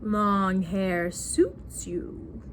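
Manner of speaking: very slow, low-pitched husband imitation; low smitten husband. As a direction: croon it low, stretching every word. Husband's compliment repeated lower.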